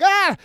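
high yelled ah; imitating a scream; mock scream. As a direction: yelp it like a beaten man. Retelling a scream.

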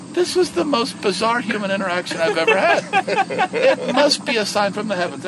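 high, loud, wondering voice; awed Raj. Retold or imitated. Raj speaking to the heavens, high and awed. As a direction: marvel aloud to the sky. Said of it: "he looks to the heavens and he goes".

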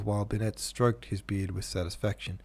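usual speaking voice

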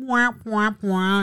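put-on baby whinge; mock whingeing. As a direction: mimic a whiner making fun of yourself. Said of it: "have a whinge".

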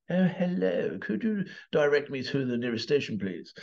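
put-on high-pitched, over-correct English film accent; mock the English of old films. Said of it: "you'd hear that accent".

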